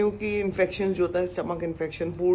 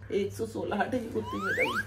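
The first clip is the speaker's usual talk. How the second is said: squeaky comic outburst; 4 dB quieter than usual. Acted. Squeaky joke voice.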